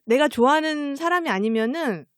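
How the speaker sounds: normal talking voice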